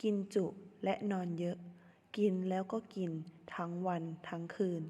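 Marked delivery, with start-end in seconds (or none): none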